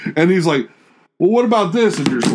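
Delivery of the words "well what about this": high, mock-innocent question; mocking a clueless coworker. Coworker asks a pointless question, mock innocent. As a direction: play dumb with a needless question.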